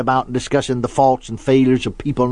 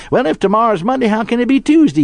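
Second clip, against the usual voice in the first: put-on high, puzzled child's protest; three-year-old son; 9 semitones higher, 3 dB louder than usual. Son challenging his father's answer, childlike puzzlement. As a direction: imitate a small boy arguing back. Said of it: "our son was just three years old".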